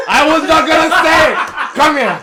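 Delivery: very high, loud playful yell; laughing shout. Real, not acted. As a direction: yell at the friend in mock outrage. Playfully threatens the teasing friend.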